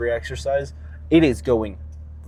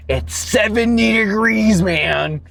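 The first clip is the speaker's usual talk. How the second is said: angry rant; 2 semitones higher, 4 dB louder than usual. Real, not acted. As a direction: yell it like you can't believe anyone would say that. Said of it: "So angry".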